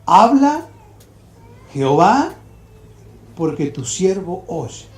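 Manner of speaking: word by word, with pauses; instructing. Acted. Old man teaches the boy his answer, instructing. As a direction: teach it phrase by phrase, rising on each name. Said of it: "le enseña al niño exactamente lo que tiene que decir".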